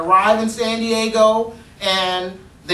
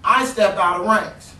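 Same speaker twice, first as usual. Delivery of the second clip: ordinary delivery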